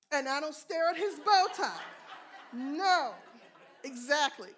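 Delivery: laughing loudly. Real, not acted. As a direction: burst out laughing at the joke. Joking back about his bow tie.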